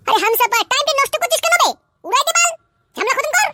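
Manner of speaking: very high-pitched, exasperated nagging; agitated high-voiced impatience; impatient urging. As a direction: goad your partner to finish it now. Accomplice urges the kill.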